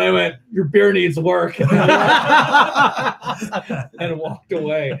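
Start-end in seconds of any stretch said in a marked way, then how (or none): 0.5-1.6 slow, higher, louder, laughing; telling the beer boss off while laughing. Retold or imitated. Retold line to beer director. 1.6-3.9 loud laugh, high; laughing at his own punchline; big laugh. Real, not acted.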